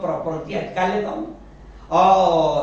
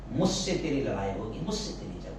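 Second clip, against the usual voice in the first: grave warning; 7 semitones lower, 6 dB quieter than usual. Acted. Preacher quotes God's warning.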